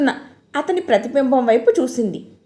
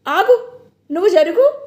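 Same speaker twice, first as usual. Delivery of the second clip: urgent; 4 semitones higher than usual. A narrator's reading. Husband takes charge urgently.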